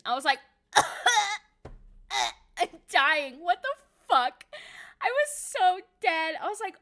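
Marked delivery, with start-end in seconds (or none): 0.7-1.4 high laugh. Real, not acted. Flustered laugh retold.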